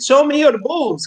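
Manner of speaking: mock Indian accent, slow; slow, hammy Indian accent. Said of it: "I can do a great Indian accent".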